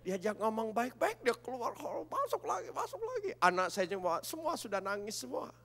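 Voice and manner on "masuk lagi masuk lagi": rising repeat. Real, not acted.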